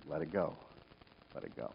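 soft, low and hushed; calm him down softly; gentle quiet command. Retold or imitated. Voices the Lord calming him.

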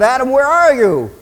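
high, loud drawn call; big swelling summons, pitched well up; God calling insistently. Acted. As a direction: holler for someone hiding. God calls Adam more insistently.

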